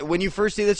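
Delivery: ordinary talk, nothing marked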